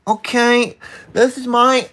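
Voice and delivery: high, slow nasal-free nerdy put-on voice; put-on nerd voice. Streamer trying out a nerdy voice for his chat, high and slow. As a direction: pitch way up and drawl it like a know-it-all. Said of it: "I'm gonna cycle through voices".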